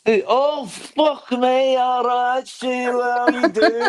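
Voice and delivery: high, mock northern English greeting; northern English accent. Greeting Sheila in her own northern English accent. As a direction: ham up a northern English hello to a friend. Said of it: "I fucking love your accent".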